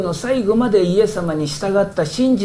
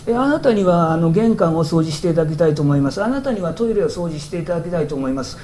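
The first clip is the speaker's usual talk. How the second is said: ordinary talk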